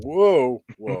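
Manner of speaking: playful startled whoa; mock alarm.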